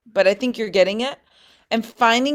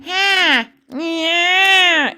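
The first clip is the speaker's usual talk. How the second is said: nasal twang; 9 semitones higher, 5 dB louder than usual. Shown as a demonstration. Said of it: "finding them through this".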